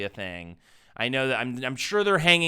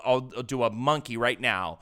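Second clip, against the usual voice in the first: ordinary talk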